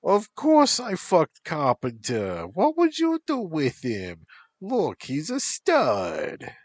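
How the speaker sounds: high, loud, slow put-on Shrek voice; imitating Shrek. Becca mimics Shrek for her friends, high and hammed-up. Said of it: "Beck imitated Shrek".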